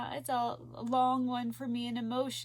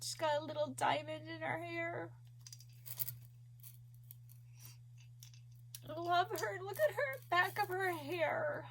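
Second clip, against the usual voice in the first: high, teary voice, moved; tearful; 4 semitones higher than usual. Real, not acted. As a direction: talk through happy tears. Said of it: "Sorry, guys, for bawling".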